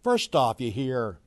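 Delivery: ordinary talk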